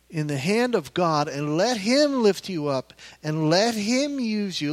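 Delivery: ordinary talk, nothing marked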